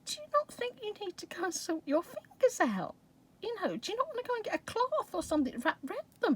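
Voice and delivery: high-pitched, concerned questioning; asking her. Retold or imitated. Asking Susie about her bandaged fingers, high-pitched questioning. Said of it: "So I'm asking her".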